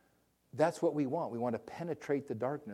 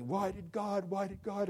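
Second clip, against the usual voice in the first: grieving father's cry; 9 semitones higher than usual. Retold or imitated. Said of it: "And he".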